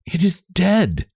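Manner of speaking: high, slow, breathless shock. A narrator's reading. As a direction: say it breathlessly, slowly taking it in. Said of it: "Frances said breathlessly".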